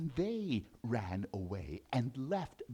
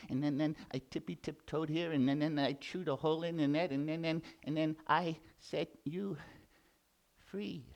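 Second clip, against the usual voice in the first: nervous quick mouse; faster than usual. Acted.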